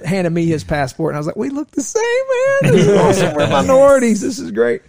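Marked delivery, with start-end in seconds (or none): 1.4-4.8 high, loud, laughing exclamation; mock delight. Traveler joking to his buddy about matching passports, mock delight. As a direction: crow it much higher and louder, amused.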